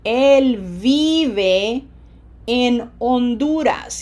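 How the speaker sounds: slow model sentence, long stressed "vi" in "vive"; pronunciation emphasis. Shown as a demonstration. Teacher gives students an example sentence, slowly stressing "vive". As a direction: draw out "vive" and "Honduras" syllable by syllable. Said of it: "Feel the pronunciation emphasis".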